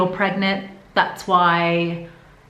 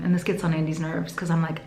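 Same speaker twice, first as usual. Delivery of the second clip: ordinary delivery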